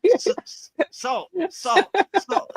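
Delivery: imitating his flustered panic over salt; mock panic. Diner in a Thai restaurant, stammering in mock panic. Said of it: "And I'm like".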